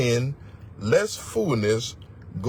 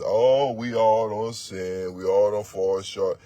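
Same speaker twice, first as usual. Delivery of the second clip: slow, lower, lightly voiced self-justifying sinner; mock excuse; 6 semitones lower, slower than usual. Preacher mimicking a sinner hiding behind we, slow mock. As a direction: drag out the excuse in a mild mock voice. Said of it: "when a person put "we" in there".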